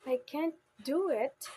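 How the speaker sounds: emphatic do in "can't do it"; stress on DO. Shown as a demonstration. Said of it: "like that".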